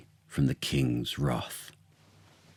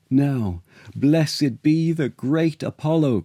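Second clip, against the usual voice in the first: higher, rejoicing cry of thanks; joyful cry; 8 semitones higher, 3 dB louder than usual. Acted. Court rejoices at the oracle's verdict, higher voice. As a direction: lift the voice in relief and praise. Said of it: "cried the whole court".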